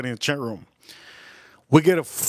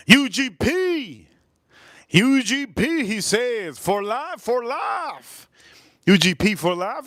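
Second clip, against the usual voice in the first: excited shout-out; 9 semitones higher, slower than usual. Real, not acted. Reading donor's super chat loudly.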